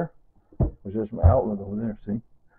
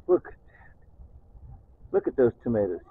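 ordinary talk, nothing marked

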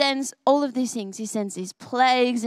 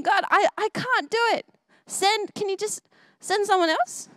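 higher, lightly voiced pleading; nervous Moses; 8 semitones higher than usual. Moses begging God to pick someone else, nervous pleading. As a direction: plead like a nervous man who feels unable. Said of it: "I'm too nervous".

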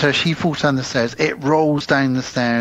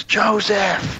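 retold shout; 8 semitones higher than usual. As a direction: imitate someone calling out a name. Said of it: "he shouts".

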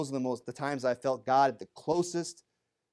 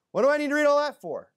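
mock whiny complaint; 13 semitones higher, 7 dB louder, faster than usual. Mock reader complaining about genealogies.

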